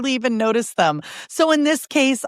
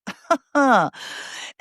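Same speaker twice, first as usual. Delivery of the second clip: laugh; 7 semitones higher than usual. Real, not acted. Laughs at the word delulu.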